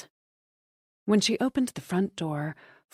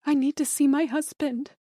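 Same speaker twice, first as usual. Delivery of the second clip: pleading; 8 semitones higher than usual. A narrator's reading. Wife insisting to detective.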